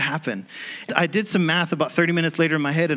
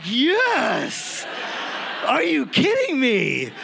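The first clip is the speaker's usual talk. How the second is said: high, laughing shout of joy; inner jubilant yell; 9 semitones higher, much slower than usual. Retold or imitated. Man hearing the job offer, cheering inside. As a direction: burst out as if you can't believe your luck. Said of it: "on the inside, I'm like".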